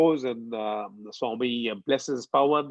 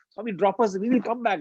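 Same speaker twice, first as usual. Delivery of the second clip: high-pitched retold pleading; eager, higher-pitched plea; 7 semitones higher than usual. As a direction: imitate kids begging for a favour. Boys plead with Swami.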